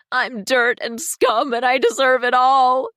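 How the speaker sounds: moaning. A narrator's reading. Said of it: "she moans".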